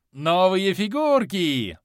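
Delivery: put-on high, loud, slow childish glee; gleeful mad god. A narrator's reading. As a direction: clap and crow like a crazed child. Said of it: "зааплодировал Кармадонт".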